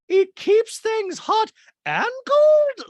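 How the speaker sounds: high loud slow caricature of a gushing rich woman; Dorit voice. Retold or imitated. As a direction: gush it in a high, loud mock-posh voice. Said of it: "And Dorit's like".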